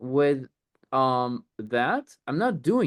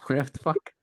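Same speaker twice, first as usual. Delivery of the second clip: laughing; 4 semitones higher, 3 dB quieter than usual. Real, not acted.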